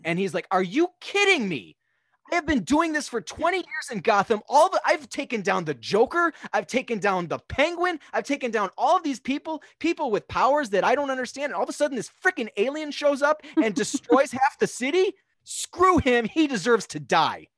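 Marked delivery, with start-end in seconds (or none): 0.5-1.8 raised, louder retold outrage; mock outrage. Batman reacting to the alien's destruction, in acted outrage. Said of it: "causes Batman to lose his mind". 2.3-15.1 high-pitched, indignant mock rant; acted rage. Veteran vigilante railing about the alien, in acted rage. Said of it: "prone to bouts of rage". 15.4-17.5 loud, high outburst slowing to a verdict; acted rage. Vigilante passing sentence on the alien, in acted rage. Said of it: "prone to bouts of rage".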